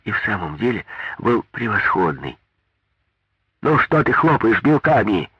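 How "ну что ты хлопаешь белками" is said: put-on shouting old woman. A narrator's reading. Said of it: "крикнула ему сухонькая старушонка".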